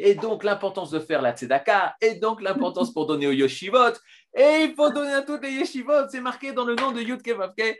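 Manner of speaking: parodie de rabbin collecteur, de plus en plus aigu et fort; rabbin qui fait la quête. Retold or imitated. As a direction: imite le rabbin qui réclame des dons pour les yeshivot, en montant. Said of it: "ils disent". (moquerie d'un rabbin qui quémande, la voix grimpe vers l'aigu)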